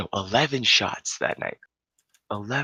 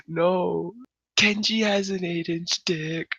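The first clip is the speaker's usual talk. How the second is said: slow, very high mock whine; imitated drunk wailing; 10 semitones higher, slower than usual. Crying drunk compares himself to another guy, high slow wail. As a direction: wail it out in jealous self-pity. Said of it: "he starts crying".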